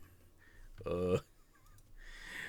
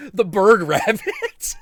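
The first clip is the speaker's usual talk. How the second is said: amused outburst; 9 dB louder, slower than usual. Real, not acted. Laughing at the name.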